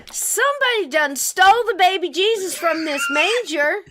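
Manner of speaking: loud, high, hammed-up indignation; a mother loudly faking outrage for her son; mock outrage. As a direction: play up the shock over the stolen baby Jesus. Mock outrage at missing Jesus.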